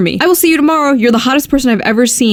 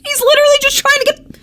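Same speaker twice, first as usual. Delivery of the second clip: much higher, squeaky outraged disbelief; can't believe what he's reading; exasperated disbelief; 11 semitones higher than usual. Real, not acted. Outraged reaction to rude text.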